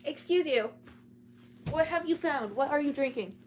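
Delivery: loud, high telling-off of a pet; startled scolding of the cat. Real, not acted. Cat drinking from her glass.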